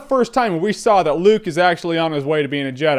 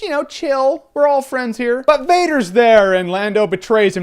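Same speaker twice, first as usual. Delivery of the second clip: normal talking voice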